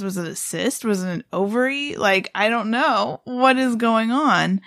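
ordinary delivery

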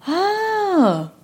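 high, drawn-out "oh"; mock amazed reaction. Stranger learns she is a voice coach, mock amazement. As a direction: imitate someone suddenly very interested. Acted reaction to her job.

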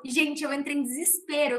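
ordinary talk

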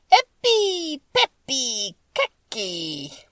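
slow, drawn-out magic words, pitch falling word by word; slow incantation. A narrator's reading. Witch standing on one foot casting a charm, slow incantation. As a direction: stretch each charm word out slowly. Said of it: "and said slowly".

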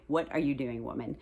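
a mock-annoyed aside, dropping low and soft; complaining neck. Her sore neck scolding her for holding arms up, low mock grumble. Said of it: "the back of my neck would be like".

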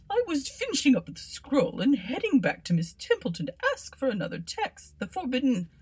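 high, flustered scholar voice. Acted. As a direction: babble it out nervously. Bobbins voiced in story.

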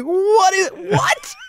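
very high incredulous squawk; stunned disbelief. Retold or imitated. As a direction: re-enact baffled shock, voice shooting way up. Acts out stunned reaction to horse.

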